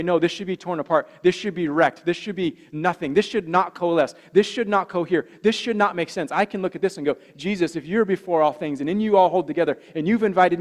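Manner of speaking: full-throated pulpit fervor; preaching at full force. Acted. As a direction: hammer home the hope to the congregation.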